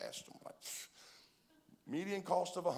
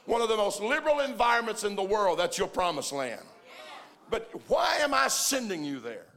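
loud, high-pitched sermon delivery; full-force preaching; 8 semitones higher, 11 dB louder than usual. Real, not acted. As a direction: preach it out to the whole congregation with rising force.

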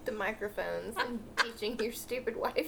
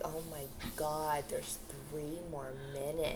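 slow, lower, unimpressed listener voice; bored listeners; 8 semitones lower, 7 dB quieter, slower than usual. Retold or imitated. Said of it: "People listening are like".

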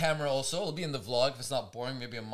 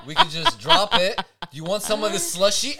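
laughing retelling; 4 semitones higher, 8 dB louder than usual. Retold or imitated. Retold, laughing, loud.

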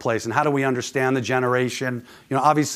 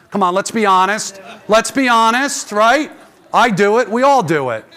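high, loud and animated; energetic crowd-rousing; 9 semitones higher, 6 dB louder than usual. Real, not acted. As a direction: pump up the room with questions. Rousing the audience with questions.

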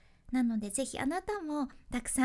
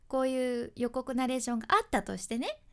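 ordinary delivery

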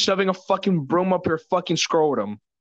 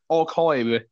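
broad British vowels on "Archive"; exaggerated British "Archive", second vowel rounded towards "oy"; mock-British pronunciation; British accent; 3 semitones lower than usual. Shown as a demonstration. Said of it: "say it in a British way".

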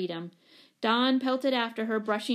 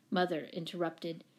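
usual speaking voice

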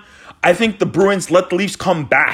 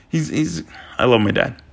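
low, soft heartfelt confession; tender, subdued; 8 semitones lower, 5 dB quieter than usual. Real, not acted. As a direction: say it softly, choked back and sincere. Tender aside about his dad.

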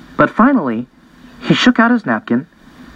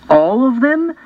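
much higher, incredulous question; shocked disbelief; 10 semitones higher than usual. A narrator's reading. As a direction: sister hears dinner plan, shocked and much higher. Sister's shocked question at table.

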